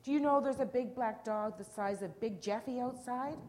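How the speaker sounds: high, loud excited question; storybook small boy raising the alarm; alarmed calling. A narrator's reading. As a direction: call it out to the whole family. Frightened boy calls to his family.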